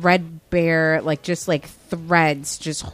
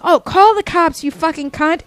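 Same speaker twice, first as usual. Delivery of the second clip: much higher, louder mock scream; imitated screaming customer; 9 semitones higher, 5 dB louder than usual. Quoting the customer cursing at the clerk, shrill mock scream. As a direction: shriek the insult like the irate customer. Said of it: "She was cursing at me".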